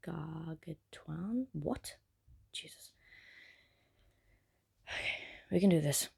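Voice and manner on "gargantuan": "Gargantuan" very slow and soft, rising; tentative sounding-out. Real, not acted. Reader stuck on a hard word, tentatively sounding it out. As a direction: sound out "Gargantuan" syllable by syllable, as a question. Stumbles over an unfamiliar word.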